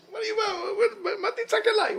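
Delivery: very high, incredulous squawk; high-pitched mock annoyance; impolite comic retort. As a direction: play an irritated God for laughs. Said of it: "God is acting not polite".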